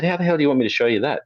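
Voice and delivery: high, fast, exasperated retort; mock-exasperated comeback; incredulous. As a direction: push back at the absurd demand. Retold reply to bully.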